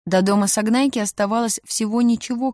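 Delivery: usual speaking voice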